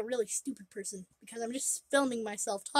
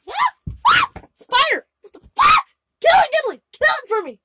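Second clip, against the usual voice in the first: high, loud terrified shrieks; panicked screaming; 14 dB louder than usual. Real, not acted. Spider lands by her leg, terrified screaming.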